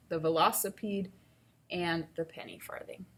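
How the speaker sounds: normal talking voice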